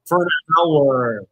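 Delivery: loud, drawn-out disbelief; incredulous challenge. Real, not acted. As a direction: stretch it out loudly in disbelief. Incredulous challenge in real argument.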